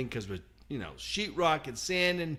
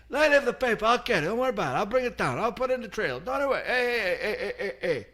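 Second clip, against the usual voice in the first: high, breezy mock painter promising everything; imitating the painter; 8 semitones higher than usual. Mocking the painter's empty promises to a friend, high sing-song. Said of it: "three days he's been saying".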